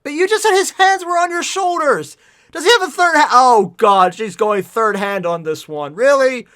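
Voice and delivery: high, very loud incredulous rant; exasperated shouting; outraged. Real, not acted. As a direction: yell the contradiction back at the author. Outraged at plot error.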